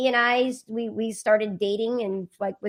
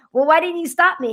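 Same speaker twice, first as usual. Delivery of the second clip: higher, louder startled question; incredulous; 9 semitones higher, 8 dB louder than usual. Retold or imitated. Instructor learning she taught ninety minutes, startled loud question. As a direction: caught off guard, demand why.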